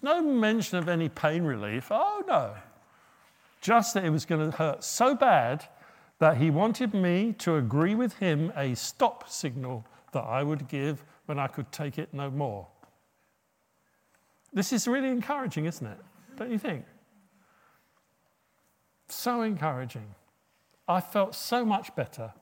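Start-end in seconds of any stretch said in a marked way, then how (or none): 1.9-2.6 mock dismay. 14.5-20.1 sarcastic. Real, not acted. Sarcastic remark on the doctor.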